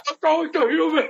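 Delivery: very high, brutish yell; screeching monster threat; Hulk voice. Acted. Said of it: "the best Hulk and Thor argument".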